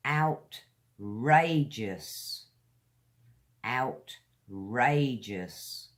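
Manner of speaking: slow "outrageous" with a gap after "out"; teacher modelling "outrageous" at dictation speed; careful pronunciation demo. Shown as a demonstration. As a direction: say "outrageous" very slowly, stretching "rage". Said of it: "pay attention to how we say these words".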